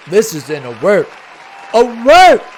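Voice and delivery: high, loud hammed-up alert shout; yelling for the producer in mock panic; mock alarm. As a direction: sound the alarm like a siren call.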